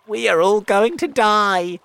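high, loud wail of doom; panicked cry. A narrator's reading. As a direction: wail in despair. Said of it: "cried the earthworm".

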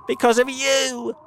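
high, loud blame; accusing. A narrator's reading. Earthworm blames centipede for the danger, accusing.

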